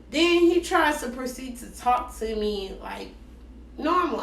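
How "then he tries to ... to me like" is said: ordinary talk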